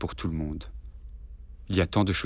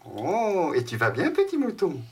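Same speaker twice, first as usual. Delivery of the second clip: high playful voice; 9 semitones higher, slower than usual. Acted. Father plays with child.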